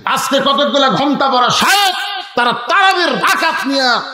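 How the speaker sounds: high, forceful denunciation; fiery preaching. Real, not acted. Preacher denouncing sheikhs silent on usury, high fiery preaching.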